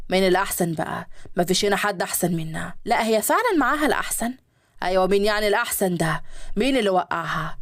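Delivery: men's voices in a read story; low male guests. Acted. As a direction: play the businessmen, voice dropped low.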